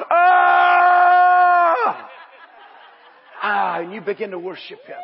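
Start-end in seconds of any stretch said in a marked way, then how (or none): 0.1-2.1 loud, high cry of wonder; joyful shout of awe. Retold or imitated. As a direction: burst out with an awestruck shout. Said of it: "you go".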